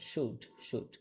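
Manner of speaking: "শুড" twice as one syllable, silent L; repeat-after-me pronunciation. Shown as a demonstration. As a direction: model "শুড" for repetition, no L sound. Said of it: "আমার সাথে একটু উচ্চারণ করার try করেন".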